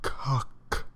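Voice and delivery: soft unvoiced tongue click; mouth click trigger sound. Shown as a demonstration. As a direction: click it softly for ASMR. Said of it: "those words that trigger that relaxation".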